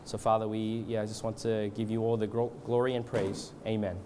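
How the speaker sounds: hushed-down, even, halting extempore prayer; leading prayer. Real, not acted. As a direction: pray aloud reverently, feeling for the words. Leads the congregation in prayer.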